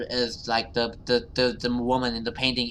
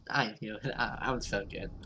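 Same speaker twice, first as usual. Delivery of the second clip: ordinary talk, nothing marked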